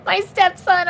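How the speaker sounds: normal talking voice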